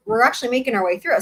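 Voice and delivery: plain reading voice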